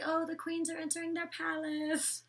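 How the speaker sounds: high, put-on mimicking voice; teasing high-pitched quote; mocking imitation. Mimics twins' comment, then laughs.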